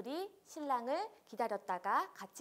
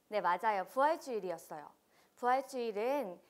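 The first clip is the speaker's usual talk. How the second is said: normal talking voice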